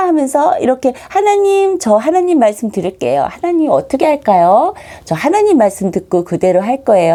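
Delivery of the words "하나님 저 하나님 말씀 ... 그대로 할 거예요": high, eager call to God; obedient, childlike asking. Acted. Believer asking God what to do, childlike and obedient. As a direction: ask sweetly, ready to obey. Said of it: "하나님 말씀 들으려고 하는 이런 사람".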